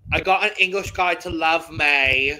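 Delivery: hammed-up attempt at a British accent; bad British accent. Shown as a demonstration. Host reading song lyrics to offend a co-host, mock British accent. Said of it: "in my worst possible British accent".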